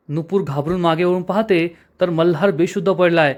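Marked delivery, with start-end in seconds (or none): none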